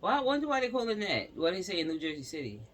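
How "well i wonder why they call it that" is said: higher, louder, quick sarcastic question; mock the phrase with raised eyebrows; sarcastic wonder. Real, not acted. Puzzled by the mayor's wording, sarcastic and quick.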